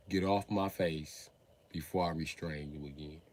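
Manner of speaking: usual speaking voice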